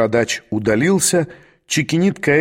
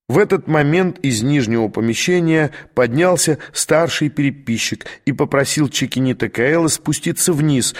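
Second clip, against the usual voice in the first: ordinary delivery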